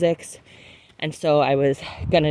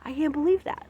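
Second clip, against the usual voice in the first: excited; 7 semitones higher than usual. Retold or imitated. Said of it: "these parts feel like".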